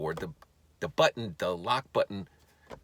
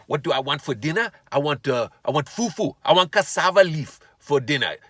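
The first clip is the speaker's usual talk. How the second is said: louder, higher mock African accent; put-on African accent; 5 semitones higher, 8 dB louder than usual. Husband answering wife about dinner, hammed-up African accent. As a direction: ham up an African accent, punching the foods. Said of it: "Just stop talking like that".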